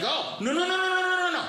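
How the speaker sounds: high rapid-fire string of denials; panicked. Retold or imitated. Man caught looking suspicious in front of onlookers, panicked. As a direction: act out a panic, voice leaping way up. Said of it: "I just panicked".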